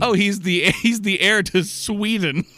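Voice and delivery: laughing out loud, high. Real, not acted. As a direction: blurt it through laughter. Laughing exclamation.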